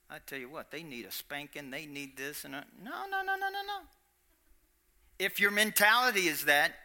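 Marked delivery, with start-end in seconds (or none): 0.1-2.6 much quieter, lower grumble about punishment; stern muttering. Retold or imitated. A harsh God muttering about spanking, quiet and stern. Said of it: "thinking that". 2.8-3.8 sharply higher rapid protest; emphatic no. Real, not acted. Preacher rejecting the idea of a punishing God, high protest.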